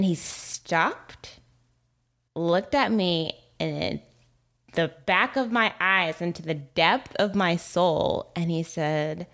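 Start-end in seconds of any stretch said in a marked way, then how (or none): none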